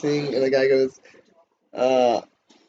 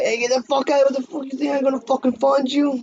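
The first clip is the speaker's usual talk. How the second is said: Tony Soprano yell; 12 semitones higher than usual. Retold or imitated.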